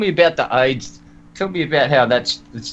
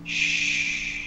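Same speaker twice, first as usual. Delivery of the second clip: mock shush; 10 dB quieter than usual. Mock shushing a silent opponent.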